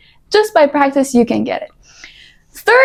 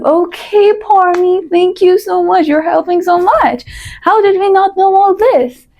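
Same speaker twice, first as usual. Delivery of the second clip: high-pitched mock gratitude; sarcastic gushing; 6 semitones higher than usual. Imagined viewer mocking the advice, sarcastic fake thanks. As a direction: thank her with exaggerated, sing-song sarcasm. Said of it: "In Sackism?".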